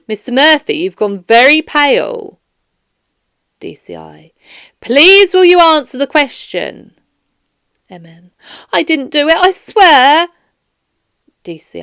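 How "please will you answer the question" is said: ordinary delivery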